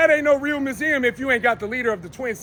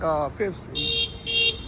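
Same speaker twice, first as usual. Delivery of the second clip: much higher, slow stretched words; drawn-out correction; 11 semitones higher, 5 dB quieter, much slower than usual. Real, not acted. Correcting his street location, high drawn-out. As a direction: stretch it out sing-song.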